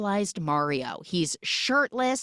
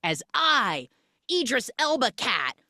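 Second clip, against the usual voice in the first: grand villain demanding victory, rising higher; Idris Elba cat; 3 semitones higher than usual. Retold or imitated. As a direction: proclaim it pompously, building to a high finish. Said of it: "He's like".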